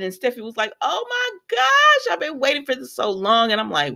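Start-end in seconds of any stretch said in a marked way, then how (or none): 0.8-3.5 retold excitement. Daughter thrilled that her parents are engaged, retold excitement. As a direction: stretch the opening into a high mock squeal. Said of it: "so she's excited".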